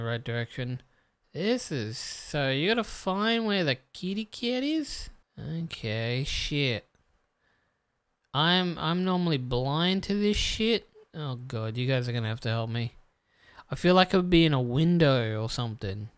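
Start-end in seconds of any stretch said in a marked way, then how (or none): none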